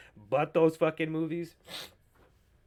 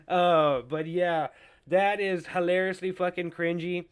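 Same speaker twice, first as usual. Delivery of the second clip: ordinary delivery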